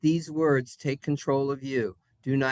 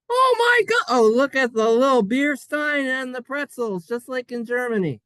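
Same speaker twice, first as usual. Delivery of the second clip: high, loud squeal of delight; mock gushing; 9 semitones higher, 6 dB louder than usual. Teacher marvelling at the beer stein and pretzels, gushing excitedly. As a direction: gush in an excited, high-pitched voice. Gushing over a pictured German scene.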